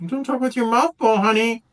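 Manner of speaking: high, mock-motherly scold; scolding parent. Mouth full of muffin, she mock-scolds herself like a mom. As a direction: chide like a mom at the dinner table. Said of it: "I can say".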